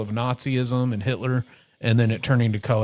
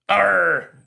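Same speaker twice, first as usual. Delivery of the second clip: pirate arr; 6 semitones higher, 5 dB louder than usual. Pretend. Pretending to be pirates.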